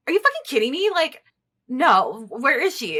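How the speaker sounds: re-live the panicked yell at him; furious disbelief. Retold or imitated. Wife retelling how she yelled at her husband over the lost dog, furious.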